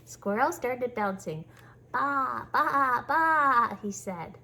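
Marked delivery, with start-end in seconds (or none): 1.9-3.8 playful baa rising to a loud bleat; bleating sheep. Pretend. Squirrel pretends to be sheep.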